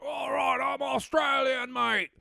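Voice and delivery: high, hammed-up Aussie bloke; broad mock-Australian war cry; Australian accent. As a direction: put on a big cartoonish Australian accent. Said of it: "He's like".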